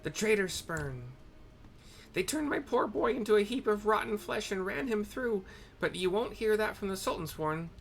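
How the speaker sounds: put-on high old woman's voice, ominous; old hag storyteller. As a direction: tell the horror like a bitter crone.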